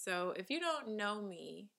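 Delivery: usual speaking voice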